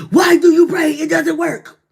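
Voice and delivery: loud, high mock rider, scoffing; skeptical rider. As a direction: throw the doubting rider's jab back loudly. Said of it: "rider say".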